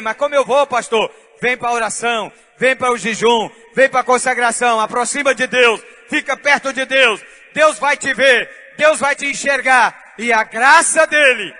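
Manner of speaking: loud, building preacher's call; preaching at full force. Real, not acted. Pastor urging believers to draw near, loud preaching.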